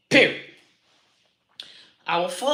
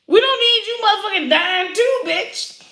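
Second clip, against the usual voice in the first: higher, louder exclaim at the mourners; 11 semitones higher, 4 dB louder than usual. Real, not acted. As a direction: shout it out at them. Yelling at mourners.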